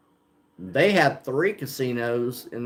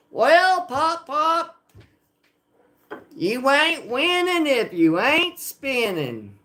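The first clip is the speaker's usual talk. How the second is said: much higher, slow put-on voice; Mega Mike impression; 11 semitones higher, slower than usual. Streamer imitates Mega Mike imitating him, high. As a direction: ham up a friend's catchphrase. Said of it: "he does a great impression of".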